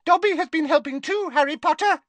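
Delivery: a tiny elf squeaking to please Harry; squeaky elf. A narrator's reading. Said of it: "he squeaked".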